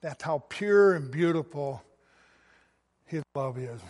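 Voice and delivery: ordinary delivery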